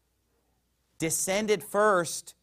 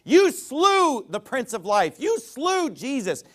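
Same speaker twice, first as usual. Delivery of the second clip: high and slow, jabbing "You"; accusing preaching; 7 semitones higher, 4 dB louder, slower than usual. Acted. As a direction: point the finger with every "You".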